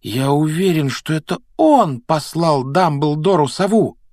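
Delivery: put-on high, emphatic boy's voice; urgent Harry. A narrator's reading. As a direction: lay out the plot in agitation. Voicing Harry's urgent speech.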